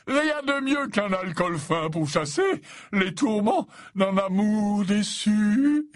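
high, slow, relishing pronouncement; jovial old professor. Acted. Slughorn consoling lovesick Ron with drink, jovial and expansive. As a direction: draw it out grandly in professor voice.

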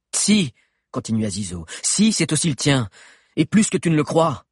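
loud, insistent. A narrator's reading. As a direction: insist forcefully. Zizo insists.

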